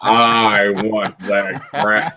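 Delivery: slow, drawn-out mock menace; hammed-up heel threat, very slow; menacing wrestler. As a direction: stretch every word like a villain eyeing his prize. Acts wrestler menacing him for crown.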